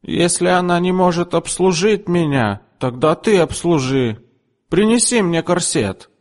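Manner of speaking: high, demanding order; imperious customer. A narrator's reading. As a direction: boss the second girl. Mrs Rainwater commands. (высокий требовательный приказ)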